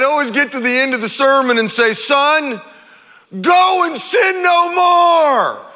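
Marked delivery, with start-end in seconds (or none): 1.9-5.7 slow, drawn-out shout, much higher and louder; mock shouted lecture. Dad shouting at his caught-red-handed kid, slow booming yell. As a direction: bellow it like an exasperated dad. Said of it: "I didn't say it like that".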